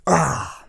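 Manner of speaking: loud sneeze; a real sneeze. Real, not acted. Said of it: "I'm about to sneeze".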